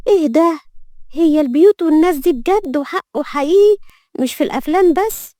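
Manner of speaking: put-on high-pitched stunned young woman's disbelief; shocked amazement. A narrator's reading. New maid stunned on entering the palace, shocked. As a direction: gape in high-pitched astonishment. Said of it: "وقالت بصدمة".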